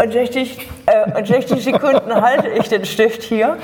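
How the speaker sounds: laughing around a pen in teeth. Shown as a demonstration. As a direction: talk through a pen held in the teeth. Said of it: "ich habe jetzt einen Stift zwischen den Zähnen".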